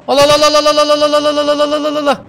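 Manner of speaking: high, rapid chanted battle yell; war cry. Pretend. Charging the enemy in a game, chanted war cry. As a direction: chant it over and over as a charging army.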